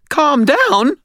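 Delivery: very high, loud outburst; high-pitched, panicked retort; hysterical disbelief. A narrator's reading. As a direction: shriek it back in disbelief. Hysterical Butters throws words back.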